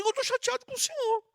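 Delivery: high, hurt complaint to God; upset prayer. Shown as a demonstration. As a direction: act out a child telling God they're upset. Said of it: "fala assim".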